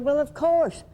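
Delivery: high, loud acted comeback; mock-emphatic and loud; emphatic assurance.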